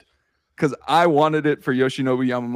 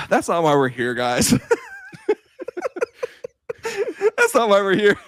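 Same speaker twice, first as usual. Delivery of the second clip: real laughter through the words; laughing protest; 9 semitones higher than usual. Real, not acted. Host laughing while protesting the tangent.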